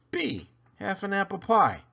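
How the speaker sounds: usual speaking voice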